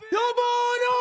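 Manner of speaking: a fan's high, loud yell for the keeper; excited shout. Real, not acted. Fan yelling at the goalkeeper.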